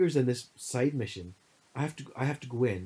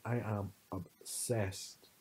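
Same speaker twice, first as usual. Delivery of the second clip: slow obsessed; 2 semitones lower, 6 dB quieter, much slower than usual. Retold or imitated. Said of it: "I was like".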